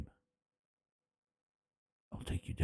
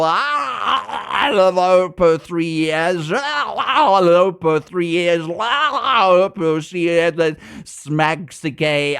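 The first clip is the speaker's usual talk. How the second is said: drawn-out wailing "ah" between slow, chanted names; mock bellowing; 3 semitones higher, 3 dB louder, slower than usual. Reading out bellowing emote names in chat.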